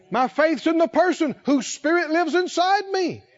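ordinary talk, nothing marked

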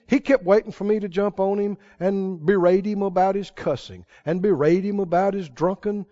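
ordinary talk

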